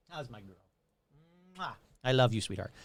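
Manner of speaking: soft, low affectionate greeting; tender aside. Real, not acted. Host greets a sweetheart mid-story, soft and tender. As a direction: drop your voice and coo lovingly.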